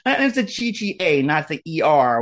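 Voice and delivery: ordinary talk, nothing marked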